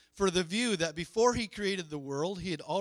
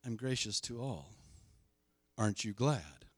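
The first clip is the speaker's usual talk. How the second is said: voicing God gently; 7 semitones lower, 4 dB quieter than usual. Preacher voicing God's gracious nature, softly. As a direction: lower and softer, a hint of God speaking. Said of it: "His nature is".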